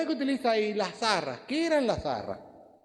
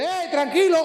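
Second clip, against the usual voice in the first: loud call, voice pitched up high; 9 semitones higher, 7 dB louder than usual. Retold or imitated. A voice calls out to the jailer about to kill himself, loud. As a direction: shout across the jail to stop him.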